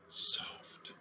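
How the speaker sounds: breathy whisper of "soft". Shown as a demonstration. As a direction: let the word fall to a whisper. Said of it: "it can sound all sorts of different ways".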